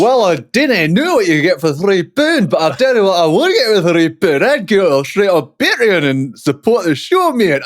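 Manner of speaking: put-on high Scottish accent drifting into Geordie. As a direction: start Scottish, slide into Geordie. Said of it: "I've gone from Scottish to a little bit Geordie".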